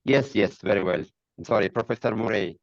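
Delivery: ordinary talk, nothing marked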